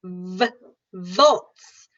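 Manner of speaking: V- with teeth on the bottom lip, then Volts; bitten V. Shown as a demonstration. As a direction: bite the lip before the vowel. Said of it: "take your teeth and put it on top".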